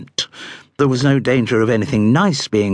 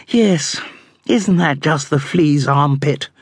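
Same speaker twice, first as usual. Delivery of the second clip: rueful; 5 semitones higher than usual. A narrator's reading. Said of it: "Twinks observed ruefully".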